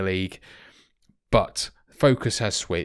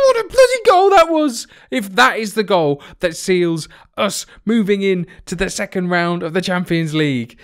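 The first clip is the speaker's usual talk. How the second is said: high, loud cry of celebration easing into raised commentary; ecstatic goal shout; 8 semitones higher, 7 dB louder than usual. Real, not acted. Fan commentating his team's goal, ecstatic shouting. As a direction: scream it out as the goal goes in.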